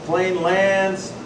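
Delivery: ordinary talk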